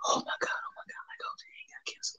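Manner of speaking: hushed whispered movie drama; mock whisper. Mocking movie doctors whispering about cancer.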